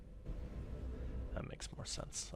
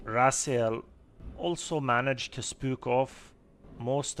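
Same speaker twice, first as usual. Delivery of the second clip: put-on Scottish accent; 3 semitones higher, 5 dB louder than usual. Gnome keeper continues.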